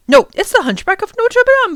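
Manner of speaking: put-on a bright, high child's guess; child voice calling out a name; excited child. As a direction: blurt it out eagerly, pitched high.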